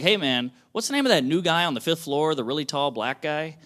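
ordinary talk

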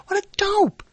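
high-pitched self-reproach; self-mocking exclamation. Real, not acted. Speaker chiding himself for forgetting the word conductor, self-mocking. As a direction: exclaim high at your own silly slip.

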